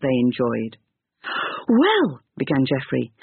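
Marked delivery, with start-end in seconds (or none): 1.7-2.2 put-on high eager boy; small boy drawing breath to speak; young boy voice. Boy voice, hesitant start.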